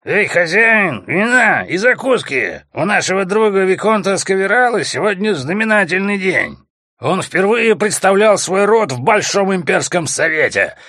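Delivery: put-on loud drunken bellow; yelling. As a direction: roar it across the tavern. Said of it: "самый здоровый среди всех заорал".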